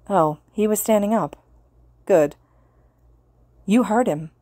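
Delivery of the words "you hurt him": ordinary delivery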